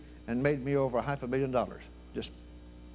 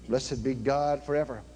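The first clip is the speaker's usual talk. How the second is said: usual speaking voice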